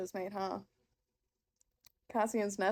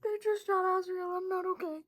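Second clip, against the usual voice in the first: high, upset disbelief; barely holding it together over the book; real high-pitched distress; distraught; 7 semitones higher than usual. Real, not acted. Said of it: "I need to compose myself".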